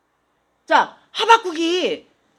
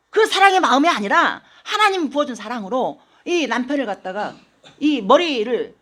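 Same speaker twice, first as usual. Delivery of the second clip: ordinary talk, nothing marked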